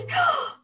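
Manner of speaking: sharp, high intake of breath; excited gasp. Real, not acted. Reader spotting own daughter, gasping with delight. Spots a child watching.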